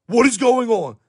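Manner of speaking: normal talking voice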